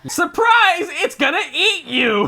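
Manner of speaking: loud, high "Surprise!"; spring it on them; mock surprise shout. Friends spoiling the plot twist with a loud surprise.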